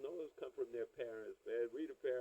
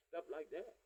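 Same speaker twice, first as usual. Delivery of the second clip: normal talking voice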